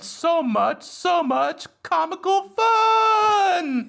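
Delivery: mock gushing. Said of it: "mock gushing review".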